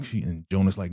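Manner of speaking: normal talking voice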